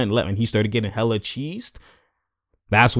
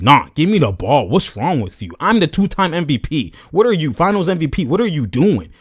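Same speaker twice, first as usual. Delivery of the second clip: higher and louder, indignant challenge; heated retort; 6 semitones higher, 4 dB louder than usual. Retold or imitated. KD snapping back at Draymond, raised and indignant. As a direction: throw the rank in his face.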